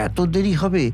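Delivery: ordinary delivery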